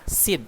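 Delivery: sid run together as one syllable; acronym read as word. Shown as a demonstration. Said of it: "nggak boleh ngomongnya".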